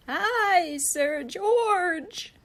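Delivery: high squeaky greeting; squeaky knight. Acted. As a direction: squeak it like a nervous little knight. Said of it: "squeaked a thin, shiny knight".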